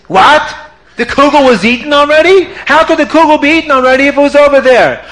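high, indignant disbelief, quickening; can't believe it was eaten; shocked disbelief. Acted. Rebbetzin to the kitchen helpers, high shocked outrage.

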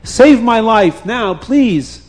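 high, insistent pleading; urgent persuasion. A narrator's reading. Tied-up man persuading the wife to save him, urgent. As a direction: talk her into it, loud and high. Captive urges his plan, begging.

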